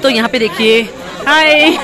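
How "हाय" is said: ordinary talk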